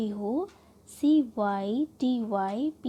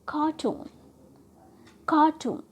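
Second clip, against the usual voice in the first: Cartoon pitched up, stress on TOON; stress on suffix; 4 semitones higher, 4 dB louder than usual. Shown as a demonstration. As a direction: put the weight on 'टून' in cartoon. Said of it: "suffix को trust देते हुए बोलेंगे". (Cartoon में 'टून' पर ज़ोर)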